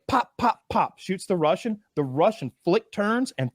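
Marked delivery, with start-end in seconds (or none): 0.1-1.0 gunshot noises. Retold or imitated. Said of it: "He goes".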